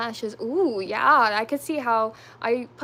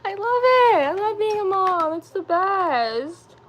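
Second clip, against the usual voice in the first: high, loud, emotional gush, close to tears; teary joy; 8 semitones higher, 6 dB louder than usual. Real, not acted. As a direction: let the love spill over, nearly crying. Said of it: "Am I really gonna cry".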